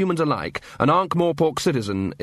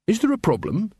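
higher-pitched, lightly voiced officer; officer's polite query; 9 semitones higher than usual. A narrator's reading. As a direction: ask with an eager, official lift. Carrot the officer voiced in a story.

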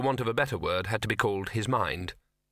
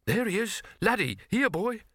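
higher, eager call to a dog; 11 semitones higher than usual. Acted. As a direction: call out with relief. Trainer calling the dog.

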